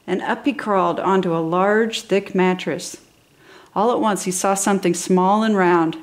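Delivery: ordinary delivery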